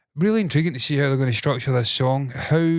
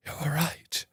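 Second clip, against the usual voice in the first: much softer, dying away on the last words; 4 semitones higher, 8 dB quieter than usual. Retold or imitated. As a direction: let the repeat fade to almost nothing. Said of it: "before I end up like Darth Vader".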